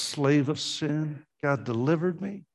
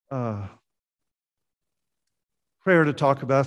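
ordinary talk, nothing marked

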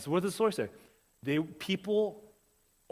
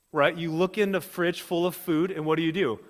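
normal talking voice

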